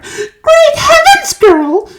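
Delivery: very high, loud slow shriek. A narrator's reading. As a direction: shriek in horror. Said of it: "shrieked Missus Beauregard".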